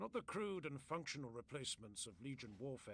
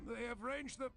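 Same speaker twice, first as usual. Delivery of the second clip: much higher, louder alarm, broken off; urgent warning cut off; 10 semitones higher, 5 dB louder than usual. A narrator's reading. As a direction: start the warning at full urgency then get cut short. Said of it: "tried to say this too and failed again".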